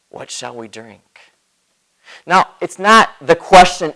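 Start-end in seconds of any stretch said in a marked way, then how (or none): 0.1-1.3 grumbling. A narrator's reading. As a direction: mutter it like a weary complainer. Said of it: "grumbled at Moses, saying".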